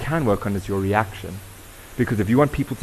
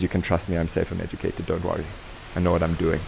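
lower, softer, calm and reassuring; soothing reassurance; 4 semitones lower, 5 dB quieter than usual. Retold or imitated. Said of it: "my skin says".

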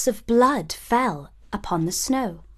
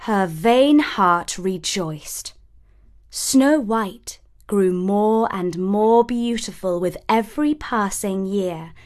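plain reading voice

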